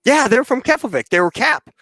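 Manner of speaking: high-pitched retold protest; agitated insistence. Duped airman arguing with base ops, agitated.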